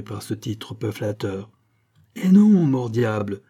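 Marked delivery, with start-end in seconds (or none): 2.2-3.4 gruff cry. A narrator's reading. A big farmer cuts into the crowd's argument, crying out. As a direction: cry out the first words over a crowd. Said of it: "cria une grosse voix".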